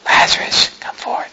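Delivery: whispered, feeble call; underplay the shout as a faint whisper; barely-there whisper, no force at all; mock tiny cry. Shown as a demonstration. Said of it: "he cried with a loud voice".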